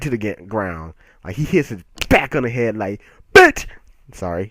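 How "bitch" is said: sudden high, loud yell; mock attack shout. Fighter hits rival on the head, mock attack shout. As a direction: yell it high as the blow lands.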